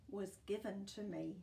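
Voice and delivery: ordinary delivery, nothing marked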